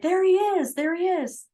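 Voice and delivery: high, excited retold cry of there he is; excited spotting. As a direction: call it out like you just spotted it. Malloy spots the lost lamb.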